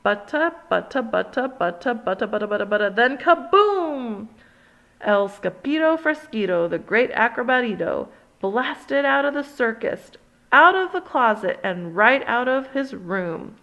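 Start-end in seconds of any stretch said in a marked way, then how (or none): 0.0-4.3 rattled drum roll into a loud boom; mock drum roll. Drum roll before the cannon fires, building to kaboom. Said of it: "Drum roll, por favor". 10.5-11.6 higher and louder; excited climax. A narrator's reading. Climax of the blast.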